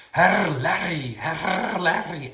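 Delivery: put-on high, loud Baltic German accent with rolled R's; Tante Lala. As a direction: sing out "Herr Larry" in slow Baltic German, trilling the R. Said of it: "say it with her Baltic accent".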